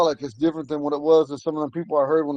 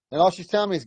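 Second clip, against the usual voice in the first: normal talking voice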